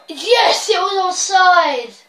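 high, loud, slow celebratory cry; elated shout. Real, not acted. Gamer seeing the goal disallowed, elated shout. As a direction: shout it out in triumph, drawn out.

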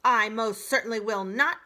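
put-on old goose refusing the gosling, squawking; squawked refusal. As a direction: snap the refusal out. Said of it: "squawked the goose".

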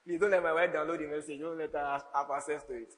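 ordinary talk

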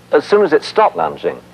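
ordinary delivery

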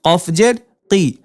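teacher's spelling drill cadence; syllable drill reading. Shown as a demonstration. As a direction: clear letter-by-letter spelling chant.

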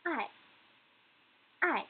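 "ai" falling straight down; fourth tone. Shown as a demonstration. Teacher pronounces the word for love, "ai" in falling fourth tone. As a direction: drop the pitch sharply through "ai". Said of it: "is the fourth tone".

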